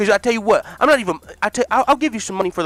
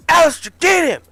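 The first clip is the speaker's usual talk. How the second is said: loud, higher command to attack; barked order; 12 semitones higher, 9 dB louder than usual. Acted. Hurt Dana yells at Alistair to get him, loud order. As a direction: call in the muscle, loud.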